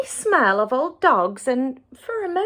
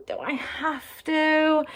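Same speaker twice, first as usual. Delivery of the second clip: glum whine; 6 semitones higher, slower than usual. A narrator's reading. Child sulking at her mother about the errand, high and whiny. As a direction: sulk it out like a reluctant child. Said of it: "with a glum face".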